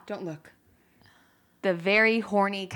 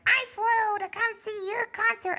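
mock fan complaint. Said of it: "'cause they're like".

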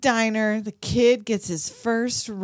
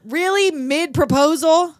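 outraged disbelief; 6 semitones higher, 7 dB louder, slower than usual. Real, not acted. Outraged at interrupted proposal.

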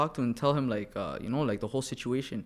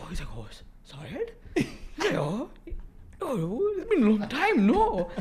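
mock surprise; 10 semitones higher, slower than usual. Retold surprised reunion voice.

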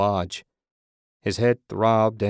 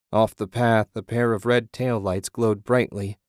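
plain reading voice

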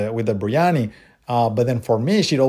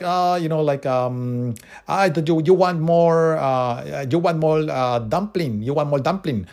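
high, lively imitation of a Chinese English accent; 4 semitones higher, slower than usual. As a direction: play the friendly Chinese restaurant lady with her Chinese accent. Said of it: "I'm gonna try to do the accent here".

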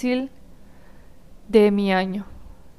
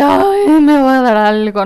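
mock panic; 3 semitones higher, 6 dB louder than usual. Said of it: "Entré así como en crisis de".

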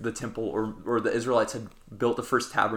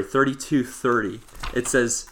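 ordinary talk

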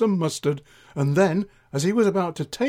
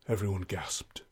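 ordinary delivery, nothing marked

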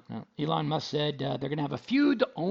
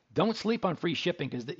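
ordinary talk, nothing marked

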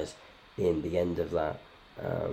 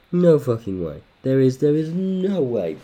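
loud, higher "No fucking way"; exclaiming; 7 semitones higher, 7 dB louder than usual. Real, not acted. Outraged at the result, loud and higher. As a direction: blurt it out in outrage. Repeated disbelief, louder.

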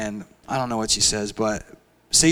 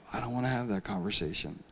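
reluctant mumble; 3 semitones lower, 13 dB quieter, faster than usual. Retold or imitated. Reluctant listener quoted.

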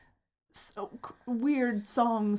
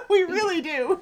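high, loud laughing gush; 10 semitones higher, 6 dB louder than usual. Real, not acted. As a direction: burst out with glee. Excited laughing.

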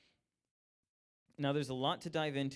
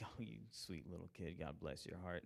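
soft, syrupy condescension; sweet mock pity for a child; patronizing coo; 5 semitones lower, 10 dB quieter than usual. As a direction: coo it down to a little kid, softer. Patronizing adult to kid.

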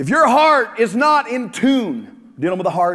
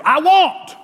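whiny shout; 6 semitones higher than usual. Acted. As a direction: blurt it out like a spoiled whiner. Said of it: "by I'm a Whiner".

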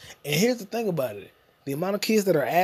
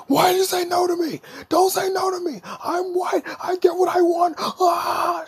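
very high crying shout; screaming tantrum kid; 14 semitones higher than usual. Retold or imitated. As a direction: wail like a spoiled brat.

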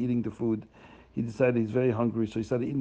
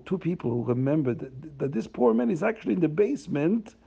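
ordinary delivery

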